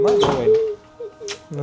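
mock crying whine. Mocking the crying voice back.

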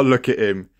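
usual speaking voice

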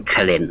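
"คาเลน" as the English pronounce it; English-style pronunciation. Shown as a demonstration. Explaining to the host how the British say Karen, English-style pronunciation. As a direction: say "คาเลน" as an anglicised name for the Karen. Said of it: "อังกฤษออกเสียงเป็น".

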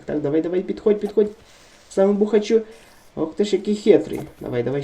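ordinary talk, nothing marked

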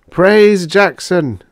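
high, loud, very slow exaltation; robot's devout cry. A narrator's reading. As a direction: cry praise like a zealot. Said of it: "two oh four cried".

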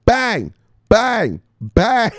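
high, loud triple bang; bang shouts. Real, not acted. Streamer celebrating a follower, shouting bang. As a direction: hammer out three bangs.

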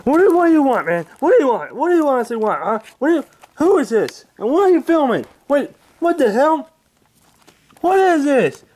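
put-on high, loud, cranky old man yelling; Angry Grandpa voice. Old man woken by pranksters, high cranky yelling. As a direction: bark at the intruders like a furious grandpa.

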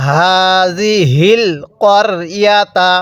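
হাযিহিল করিয়াতা slow, short ক not কা; correct tajweed recitation. Shown as a demonstration. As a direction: recite slowly with correct vowels. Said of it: "তাহলে কিভাবে পড়তে হবে?".

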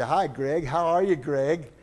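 sing-song hi to little Greg; cheery greeting. Retold or imitated. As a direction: greet the boy with delight.

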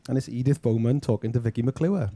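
ordinary talk, nothing marked

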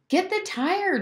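very high hollered order; high-pitched boyish call; hollering. A narrator's reading. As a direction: holler it high, without full volume. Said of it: "Jem hollered".

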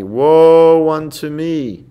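slow mournful lament of a shoemaker; sighing lament. Retold or imitated. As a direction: sigh it out like a weary shoemaker. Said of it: "utter a sigh".

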